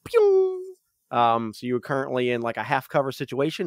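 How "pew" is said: high, loud pew sound effect; zing it like a ricochet; bullet ricochet pew. Retold or imitated. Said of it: "you hear, like, a pew".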